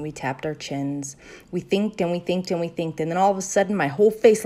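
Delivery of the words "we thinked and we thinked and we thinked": plain reading voice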